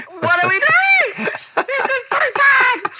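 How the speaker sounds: hammed-up sob through laughter, slow; mock crying. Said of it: "Everyone is crying".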